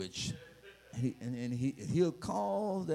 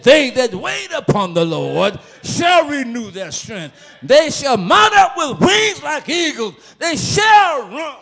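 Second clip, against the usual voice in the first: louder, higher, slow and ringing; full-force preaching; 8 semitones higher, 15 dB louder than usual. Acted. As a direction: proclaim the verse to the whole church.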